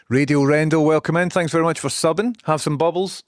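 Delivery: hosting a loud hello to a new subscriber; big welcome. Acted. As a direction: boom it out like a radio host.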